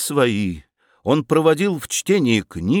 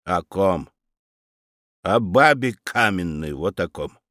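scornful; 7 semitones lower than usual. A narrator's reading. Aunt scornfully names the stone statue, low. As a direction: drop the voice in contempt.

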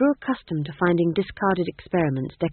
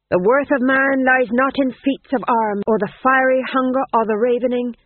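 declaiming actor; 5 semitones higher, 6 dB louder than usual. A narrator's reading. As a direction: proclaim like a stage hero. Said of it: "His voice became deeper, his right hand flung out dramatically".

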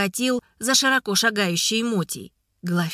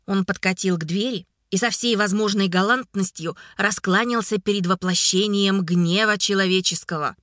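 plain reading voice

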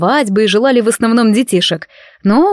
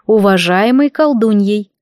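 ordinary delivery

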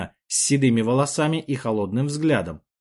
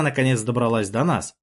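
plain reading voice

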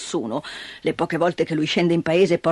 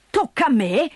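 raised, incredulous echo; indignant; 4 semitones higher than usual. Acted. Old man echoes Dete indignantly, incredulous. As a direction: throw it back at her in outrage.

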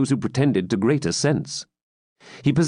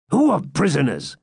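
higher impatient exclamation; 6 semitones higher than usual. A narrator's reading. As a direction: demand it impatiently. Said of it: "exclaimed Gurth impatiently".

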